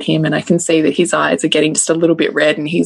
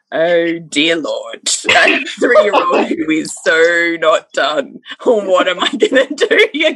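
slow exasperated; slower than usual. Retold or imitated. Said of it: "I'm like".

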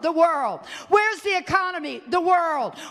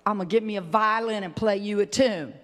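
sarcastic; 7 semitones lower than usual. Pretend. Sarcastic violin joke to sulker.

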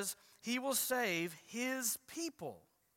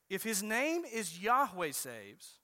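normal talking voice

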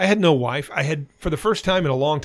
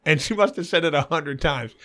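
ordinary talk

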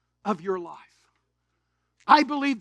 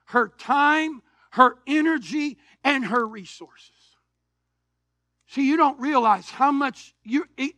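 usual speaking voice

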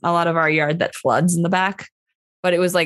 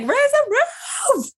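high, slow party-hype voice; mock party shout; much slower than usual. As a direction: hype the crowd, stretched out. Voicing cheering windshield wipers.

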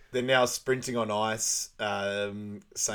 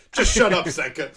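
much higher, louder snap; mock irritated snap; 6 semitones higher, 9 dB louder than usual. Derice cutting off Sanka, mock irritated snap. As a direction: shut him down.

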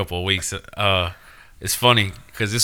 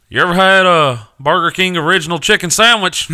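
dopey guy voice; 9 semitones higher, 7 dB louder than usual. Retold or imitated. Voicing dim coworker.

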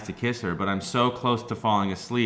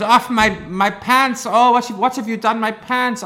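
mock upset woman; 12 semitones higher, 5 dB louder than usual. Retelling the girl upset about her pants, high and whiny.

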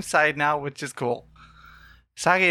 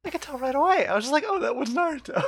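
high, sing-song mock boast; playful brag about spotting Naruto; mock smug; 11 semitones higher, faster than usual.

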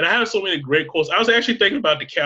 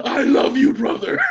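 loud, high, gushing declaration; excited shout; 11 semitones higher, 5 dB louder, slower than usual. Retold or imitated. Farley yelling his love at his brother, loud and high. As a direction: shout it with overjoyed affection. Quoting Tommy Boy hug scene.

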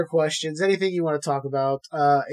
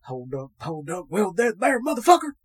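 acted quote; 2 semitones higher than usual. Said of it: "seeing him come up and go".

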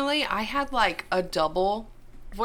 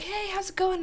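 imitating a friendly boy; 11 semitones higher than usual. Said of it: "he was like".

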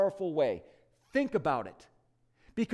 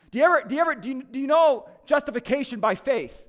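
louder and higher, a pressing interrogation; stern angel; 6 semitones higher, 7 dB louder, faster than usual. Retold or imitated. Supervising angel quizzing the thief on doctrine, loud and pressing. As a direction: grill him like an official.

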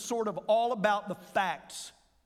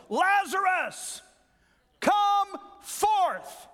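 high ringing shout, much higher and louder; loud cry; 11 semitones higher, 6 dB louder, much slower than usual. Shown as a demonstration. Preacher re-reading Jesus calling Lazarus from the tomb, full shout. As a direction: call him out of the tomb at full voice. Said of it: "He cried with a loud voice".

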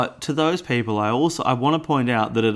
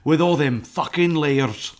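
Scottish Shrek, sneering and higher; 3 semitones higher than usual. Acted. Mocking ogre layers to his cohost in a Scottish Shrek voice. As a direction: grumble it like a crude Scottish ogre. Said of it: "That was also Shrek corner".

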